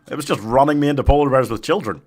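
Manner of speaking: usual speaking voice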